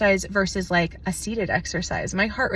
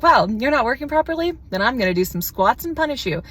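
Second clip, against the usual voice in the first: mock scolding; 5 semitones higher than usual. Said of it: "I'm like".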